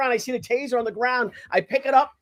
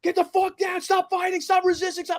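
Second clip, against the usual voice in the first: high, urgent imitation of cops yelling; yell-like orders pitched high, without much extra volume; retold shouting; 10 semitones higher than usual. Cops fighting a man who resists, mock shouting. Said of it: "I hear this shouting and screaming".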